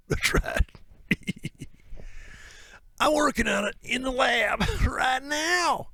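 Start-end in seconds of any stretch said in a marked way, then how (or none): 3.0-5.9 high, shouted with laughter; mock villain. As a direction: cackle out a mad-scientist boast. Voiced conspiracy figure, shouting.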